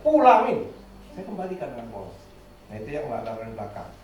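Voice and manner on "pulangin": much higher, louder stern command; sharp mother's voice sending the toy back; mother's angry order. Retold or imitated. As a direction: snap the order at the child.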